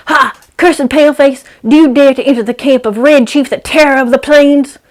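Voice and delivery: high, loud boy playing a fierce Indian chief; war-chief boy. Boy challenges kidnapper entering his camp, high loud mock war cry. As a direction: shout it like a kid playing warrior. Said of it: "He points a stick at me ... and says".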